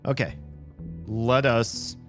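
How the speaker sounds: usual speaking voice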